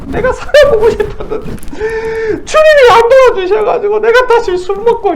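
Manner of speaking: put-on high, whiny drunken complaint; drunk complaining. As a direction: whine like a drunk blaming God. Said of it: "나한테 짜증 내고 신경질 내고". (술 취해 징징대는 목소리)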